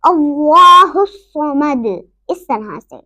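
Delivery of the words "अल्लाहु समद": slow अल्लाहु समद, rounded and heavy; full recitation, qalqala. Shown as a demonstration. Teacher answers how to read it, full heavy recitation. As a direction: stretch अल्लाहु and echo the D of समद. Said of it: "हम उसको कैसे पढ़ेंगे".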